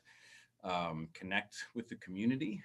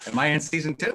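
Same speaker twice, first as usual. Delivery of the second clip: louder, higher mock panic; mock alarm; 7 semitones higher, 15 dB louder, faster than usual. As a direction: act shocked. Mock alarm about role.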